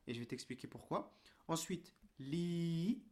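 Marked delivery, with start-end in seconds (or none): 2.2-3.0 "li" with a long, held i; prolonged vowel. Shown as a demonstration. Teacher reading the next syllable, prolonged vowel. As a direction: draw out the vowel of "li". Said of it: "de prolongation".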